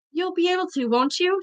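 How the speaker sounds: soft, rushed and pleading; desperate plea. A narrator's reading. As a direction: beg for reassurance. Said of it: "said Harry desperately".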